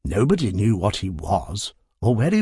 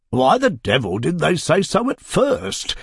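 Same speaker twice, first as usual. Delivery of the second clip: higher, petulant complaint; pettish; 6 semitones higher than usual. Acted. Mister Joseph Tuggs complaining to his wife, pettish. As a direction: grumble like a sulky child. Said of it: "rather pettishly".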